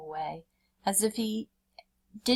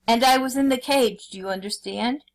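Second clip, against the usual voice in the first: forceful tiger; 5 semitones higher, 6 dB louder than usual. A narrator's reading.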